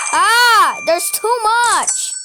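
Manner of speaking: high, loud delighted cry; overjoyed. Real, not acted. Player overjoyed at too much money, high excited cry. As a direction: gush over the loot.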